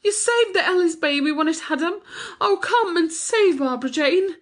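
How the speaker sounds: very high, panicked wail; distraught, tearful pleading. A narrator's reading. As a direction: plead desperately for the baby's life. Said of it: "wringing her hands and sobbing".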